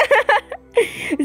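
a short helpless laugh; laughing at the prank; laughter. Real, not acted. Giggling fit.

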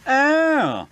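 high, loud, falling "oh"; haughty sniff. A narrator's reading. Elizabeth takes in smelly Whiff, sniffing. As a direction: exclaim it with a grand sniff. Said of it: "sniffed Elizabeth".

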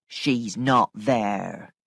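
low, slow housekeeper voice; kindly firm housekeeper, reassuring. A narrator's reading. Missus Grose telling Flora nobody is there, low and reassuring. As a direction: deny it slowly in a low voice.

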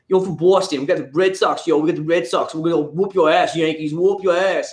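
mock Boston sports fan taunting in a Boston accent; heavy Boston accent. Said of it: "like the really heavy Boston".

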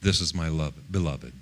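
low, hushed voice from heaven; voice of God. Retold or imitated. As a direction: quietly voice the Father, pitched low. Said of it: "he heard the voice say".

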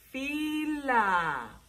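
"fila" stretched as fii-laa; drawn-out syllables. Shown as a demonstration. Teacher introducing a word with fi, "fila" in long syllables. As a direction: hold each syllable of "fila". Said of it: "dos sílabas".

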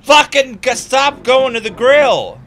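loud, high, slowed furious command; angry yell. Real, not acted. Streamer yelling at his character to stop grilling, angry yell. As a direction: bark it at the stubborn character.

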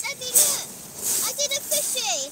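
loud, high excited call; excited shout. Real, not acted. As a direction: call out proudly, full of excitement. Child excitedly calling to dad.

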